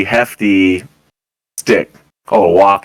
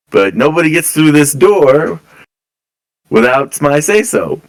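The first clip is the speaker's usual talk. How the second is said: stern guard; 5 semitones higher than usual. Acted. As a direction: lay down the law at the door. Guard warns nobody passes.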